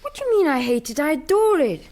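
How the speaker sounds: indignant protest. Acted. Simon denies to Ronnie that Simon hates it, indignant protest. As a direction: protest loudly, building as you go. Indignant denial in a lovers' quarrel.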